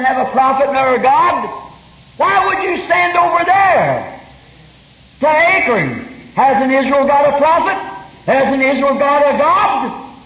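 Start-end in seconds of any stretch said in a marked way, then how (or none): 2.2-10.1 preaching shout. Real, not acted. Preacher voicing Elijah's challenge to the king, raised. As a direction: drive each question home.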